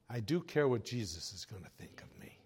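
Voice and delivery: soft, whispered confession. Real, not acted. Preacher confiding to the congregation in a whisper. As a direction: whisper it as a secret truth. Confessing his true concern.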